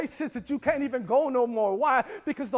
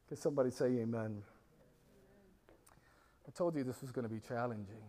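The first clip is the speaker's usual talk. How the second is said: much lower, softer and fast; quick low murmur to the crowd; quiet aside; 10 semitones lower, 10 dB quieter, faster than usual. Real, not acted. Aside to congregation.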